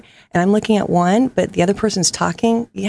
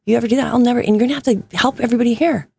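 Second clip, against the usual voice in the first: urgent plea; 6 semitones higher, much faster than usual. Retold or imitated. Urging the girl not to act.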